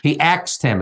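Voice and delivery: "axed" said in place of "asked"; mispronounced "asked". Shown as a demonstration. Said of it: "It's not axed".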